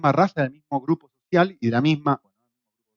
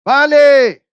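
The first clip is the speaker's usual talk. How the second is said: loud call to a far worker; shouted Spanish worker's vale; shouting across a site; 9 semitones higher, 9 dB louder than usual. Retold or imitated. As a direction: yell it down the rope. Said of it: "el hombre de la otra punta grita".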